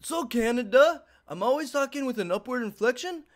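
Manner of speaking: high and loud, every phrase tipped upward; mocking upward inflection. Mocking a houseguest who ends sentences rising, high and loud. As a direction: parody a sing-song rising lilt. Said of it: "He fucking talks like".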